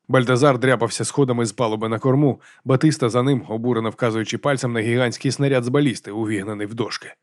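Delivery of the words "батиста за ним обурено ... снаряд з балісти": ordinary delivery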